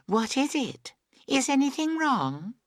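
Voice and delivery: put-on high, girlish questioning; girl's voice. A narrator's reading. Emily comes back and asks, high girlish voice.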